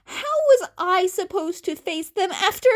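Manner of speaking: high, loud, crying outburst; voice breaking. A narrator's reading. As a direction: despair cracking into sobs. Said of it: "The teen's voice broke".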